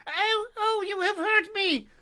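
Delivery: high, loud wail of pain; a tiny voice crying out, hurt; the little voice in the log wails in pain; doleful cry. A narrator's reading. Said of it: "cried the same little voice dolefully".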